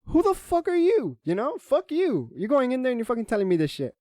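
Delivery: heated, high-pitched venting; indignant outburst. Real, not acted. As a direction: tell him off, pitch jumping up. Angry rant at absent teammate.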